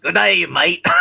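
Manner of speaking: loud Australian Wolverine greeting; Australian accent. Pretend. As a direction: shout it like a brash Australian. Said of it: "I can't do, uh, Australian Wolverine".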